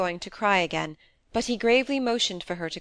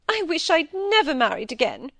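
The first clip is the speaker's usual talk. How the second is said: high, louder wail, lightly acted crying; tearful; 10 semitones higher, 5 dB louder than usual. A narrator's reading. Wife regrets marrying under questioning, acted tearfulness. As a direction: wail it as if near tears. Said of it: "now fairly crying".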